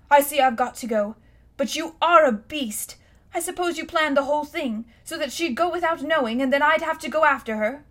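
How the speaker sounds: put-on a boy calling his uncle a beast; angry boy. Boy voiced in the story.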